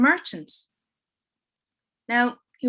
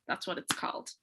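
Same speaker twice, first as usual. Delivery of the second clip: hushed whisper; conspiratorial whispered aside; 6 semitones lower, 11 dB quieter than usual. Acted. Whispered aside in spooky story.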